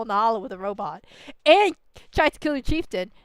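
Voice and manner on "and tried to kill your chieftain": ordinary talk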